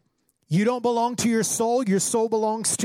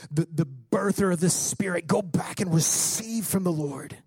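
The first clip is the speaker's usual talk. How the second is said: usual speaking voice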